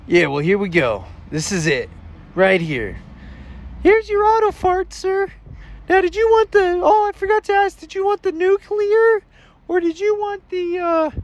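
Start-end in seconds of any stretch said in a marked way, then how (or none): 3.8-11.1 very high, squeaky customer-service voice; high cartoon shop assistant; shop clerk character. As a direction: pitch it way up as an eager clerk. Plays the auto shop clerk.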